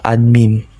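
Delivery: ordinary delivery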